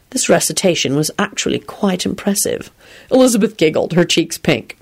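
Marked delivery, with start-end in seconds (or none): none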